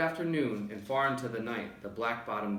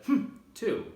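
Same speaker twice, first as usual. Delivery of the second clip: high little echoing humph; small joey copying its mother; piping mimic voice; young kangaroo; 13 semitones higher, 6 dB louder than usual. A narrator's reading. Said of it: "the young kangaroo in her pouch said".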